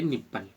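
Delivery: निप्पल said softly, the i short like ee; say nipple. Shown as a demonstration. Teacher modelling the pronunciation for learners, softly. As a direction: close the rule with a soft निप्पल. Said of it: "इसका उच्चारण हम ई से करेंगे".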